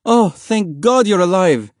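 high, loud, slow sob of relief; relieved mother. A narrator's reading. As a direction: cry out in relief.

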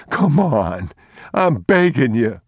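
high, loud, frantic pleading; a cornered thief begging the father; desperate thief. Acted. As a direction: plead for your life, voice cracking up. Thief final plea.

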